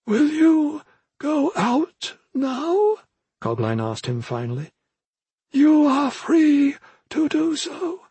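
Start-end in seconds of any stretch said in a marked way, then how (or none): 0.1-3.0 very high, slow spirit voice; solemn old mentor. Acted. 5.5-8.1 very high, slow spirit voice; solemn old mentor. Acted.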